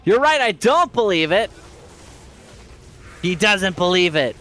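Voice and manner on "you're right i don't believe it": ordinary talk, nothing marked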